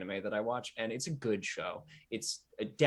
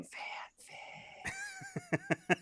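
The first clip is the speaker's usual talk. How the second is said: whisper yelling; 5 dB quieter than usual. Real, not acted. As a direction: whisper shout. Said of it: "I whisper shout into my microphone".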